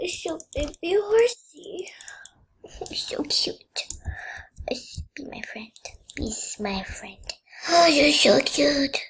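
put-on cooing baby talk. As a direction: coo at the baby horse.

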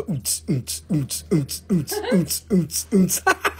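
mouthed thumping club beat, oots oots; beatbox a lazy disco thump under the breath. Retold or imitated. Host mimics disco music for a rock, mouthed beat. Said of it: "This is a disco".

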